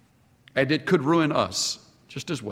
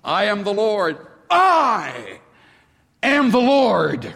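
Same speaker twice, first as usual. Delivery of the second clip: "I", a pause, then "am the Lord" higher, slower and louder; emphatic repetition; 5 semitones higher, 3 dB louder than usual. Shown as a demonstration. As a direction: hit "am" hard on the repeat for emphasis. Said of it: "Repetition is used".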